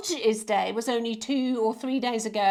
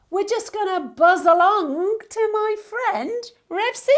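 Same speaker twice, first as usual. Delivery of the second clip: higher and louder, bouncy lead-in; bubbly handover; 9 semitones higher, 7 dB louder than usual. Acted. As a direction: buzz us along cheerfully. Playful handover to guest film.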